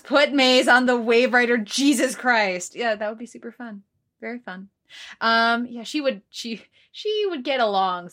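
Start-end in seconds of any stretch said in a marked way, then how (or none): 0.0-2.8 loud, slow emphatic read; all caps. Acted. Host reading a listener's all-caps message, loud and emphatic. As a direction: punch out every word loudly. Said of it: "all caps".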